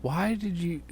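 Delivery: ordinary talk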